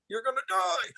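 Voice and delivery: a hint of an echoing oracle. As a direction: cave oracle's soft prophecy. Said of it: "when that voice comes down".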